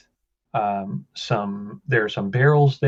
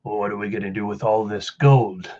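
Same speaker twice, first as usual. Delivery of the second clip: a lightly lowered man's voice; low, secretive villain voice; deeper voice; 4 semitones lower than usual. Acted. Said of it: "the deeper voice".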